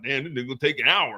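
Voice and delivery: low dismissive heckler; deep mocking chat voice. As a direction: drop low like a sneering heckler. Voicing the chat insulting him.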